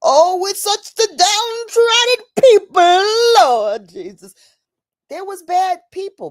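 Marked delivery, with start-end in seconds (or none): 0.0-4.3 high, loud, wailing pity; hammed-up mournful pity; mock lament. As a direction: ham up the pitying sigh to the Lord. Mocking pitying lament.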